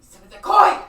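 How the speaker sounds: loud high battle cry at the enemy; yell. Acted. Swordsman yells at the wolves circling him, full-force high yell. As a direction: yell it out as a challenge. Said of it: "He, like, yells at them".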